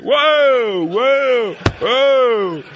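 loud, high joyful whoas; excited whoops. Retold or imitated. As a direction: cheer like he just caught a pass. Celebrating catching baby.

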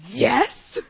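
loud, high burst of yes; a timid girl's sudden shout; excited yell. Retold or imitated. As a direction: blurt it out with joy.

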